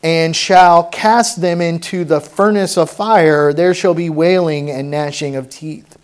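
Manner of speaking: loud, slow, weighty Bible reading; preacher reading out a stern warning; forceful scripture reading. Acted. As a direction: proclaim the verse slowly and forcefully.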